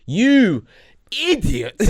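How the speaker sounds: drawn-out, high-pitched mock insult; mock outrage. Appalled friend reacting to land sold in the metaverse, mock outrage.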